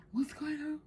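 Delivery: high, soft gasped question imitating a startled friend; startled gasp, retold. Friend from work reacting to the reading, startled. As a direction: mimic a friend's quiet, high-pitched gasp of surprise. Friend gasps in mock alarm.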